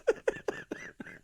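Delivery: high squealing laugh; shrieking laugh. Real, not acted. Host cracking up at his own joke. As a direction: burst into a squeal.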